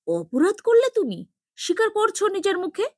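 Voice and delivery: high, fast, astonished question; sister-in-law stunned by his confession, high and fast; stunned disbelief. A narrator's reading. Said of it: "পারুল হতবাক হয়েও".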